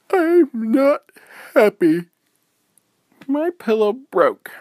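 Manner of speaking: high, loud sulky wail; pouty whine. Acted. Lexi wailing high and loud that her pillow broke. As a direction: sulk like a child. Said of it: "So Lexi said".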